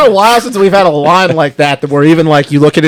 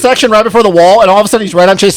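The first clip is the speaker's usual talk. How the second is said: normal talking voice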